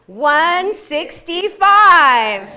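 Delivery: slow, loud, booming number; hammed-up announcer boom; loud drawn-out repeat. Shown as a demonstration. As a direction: belt it out slowly for emphasis. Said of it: "What did I say?".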